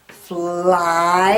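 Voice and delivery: fly said loud with a long I; loud teacher model of fly; stretched fly. Shown as a demonstration. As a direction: lean on the I in fly. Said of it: "you hear the I?".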